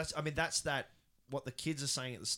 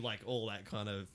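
usual speaking voice

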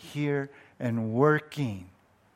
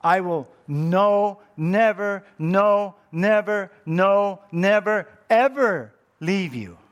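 each NEVER punched high and loud; slow, loud, emphatic promise; 4 semitones higher, 6 dB louder, slower than usual. Shown as a demonstration. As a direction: hammer no, never, no, never. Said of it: "It's more like".

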